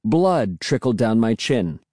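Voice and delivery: ordinary delivery, nothing marked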